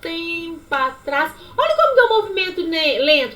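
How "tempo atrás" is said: high "Ih", then "há muito tempo atrás" very slow; slow delivery. Shown as a demonstration. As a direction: drag the words out like a slow movement. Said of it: "exemplo de movimento lento". (fala lenta e arrastada)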